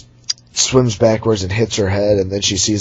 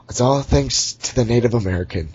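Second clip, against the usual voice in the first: usual speaking voice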